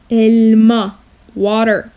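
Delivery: ordinary delivery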